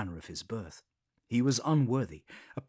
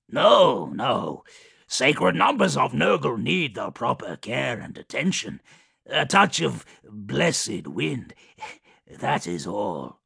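Kugoth voice; 2 semitones higher, 8 dB louder, slower than usual. Acted. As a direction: bark back, busy and proud. Kugoth hastily denies.

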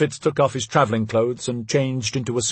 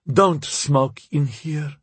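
slow, higher-pitched curt order; irritable wife; 5 semitones higher, much slower than usual. A narrator's reading. Wife to husband taking out cigars, irritably forbidding. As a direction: forbid it slowly in the wife's character voice.

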